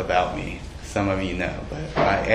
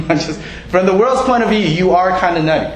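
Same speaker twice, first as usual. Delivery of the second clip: teasing; 6 semitones higher, 3 dB louder than usual. Real, not acted. Teasing the congregation.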